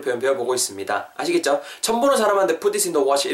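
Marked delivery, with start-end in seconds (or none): none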